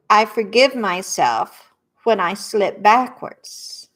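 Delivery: a calm coach setting the words out one by one; tapping affirmations. Shown as a demonstration. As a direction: lead the group through each phrase. Repeat-after-me tapping affirmations.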